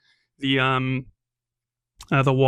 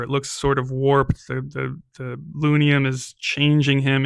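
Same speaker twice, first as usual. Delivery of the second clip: ordinary talk, nothing marked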